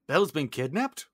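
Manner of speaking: acted surprised query after a gasp; Gaston taken aback. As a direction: echo the news in disbelief. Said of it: "And Gaston's like".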